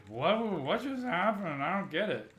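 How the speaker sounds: hammed-up confused wail; mock bewilderment. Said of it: "I was always like".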